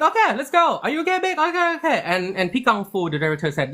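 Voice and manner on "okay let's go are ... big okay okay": high, hurried rallying of the team; re-enacted eager high-pitched call; eager call to start. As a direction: pitch it up, keen and checking in. Recalls calling crew to start.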